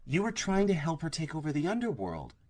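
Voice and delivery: rising disbelief; realizing with alarm; shocked realization. A narrator's reading. Realization dawning.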